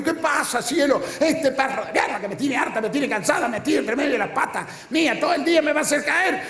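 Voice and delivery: high, whiny, fed-up wife complaining about the dog; high whining wife. Retold or imitated. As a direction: play the exasperated wife ranting about the dog.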